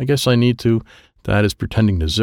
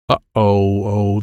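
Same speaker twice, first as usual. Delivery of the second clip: plain reading voice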